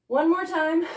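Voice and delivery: breathless after dancing; panting between words; out of breath. Real, not acted. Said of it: "I'm really out of breath".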